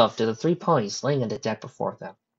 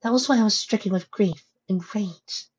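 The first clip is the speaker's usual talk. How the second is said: lightly voiced pony, higher pitch; a hint of a gentle character voice; 10 semitones higher than usual. Pony confiding in her friend, lightly voiced higher.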